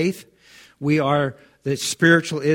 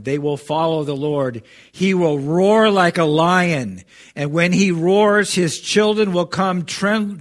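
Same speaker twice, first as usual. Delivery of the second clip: ringing sermon climax; preaching at full force; 3 semitones higher, 5 dB louder than usual. Acted. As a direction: roar it out over the congregation. Preacher reads God's roar passage.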